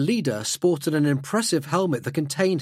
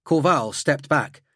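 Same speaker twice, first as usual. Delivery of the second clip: ordinary delivery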